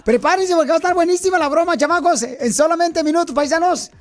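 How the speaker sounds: high, fast radio hype; hyped teaser. Real, not acted. Host teasing the prank to listeners, hyped.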